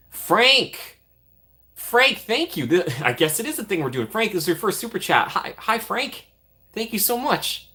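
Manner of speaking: high, delighted thanks; excited squeal. Real, not acted. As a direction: squeal his name with glee. Surprise at first super chat.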